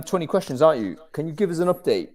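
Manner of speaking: normal talking voice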